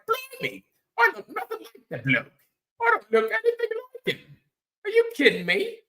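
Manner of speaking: high-pitched mock British indignation; hammed-up British disbelief; mock British accent. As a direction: put on a squeaky, affronted British accent. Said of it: "whatever the English, the British say".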